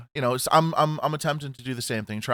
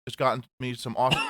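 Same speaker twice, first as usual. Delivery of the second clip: ordinary delivery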